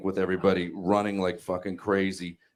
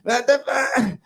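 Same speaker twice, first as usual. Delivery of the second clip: much higher, louder whiny wife voice; mocking wife; 6 dB louder than usual. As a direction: squeal it like a defensive wife. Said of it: "And she's like".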